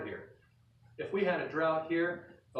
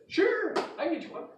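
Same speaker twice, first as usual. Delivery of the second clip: much higher, bright offer; cheerful agreement; 14 semitones higher, 5 dB louder, faster than usual. Retold or imitated. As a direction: chirp it willingly. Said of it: "Maybe she said".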